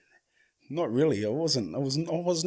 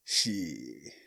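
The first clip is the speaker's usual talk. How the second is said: much lower, softer muttered shit; dismayed; 11 semitones lower, 8 dB quieter than usual. Real, not acted. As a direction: drop it low in dismay. Looking at flames artwork.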